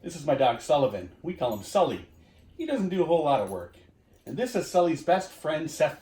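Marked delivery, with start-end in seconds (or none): none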